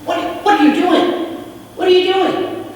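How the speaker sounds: high, loud, suspicious demand; alarmed demand. A narrator's reading. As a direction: confront the stranger, alarmed and distrustful. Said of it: "looked at him and he said".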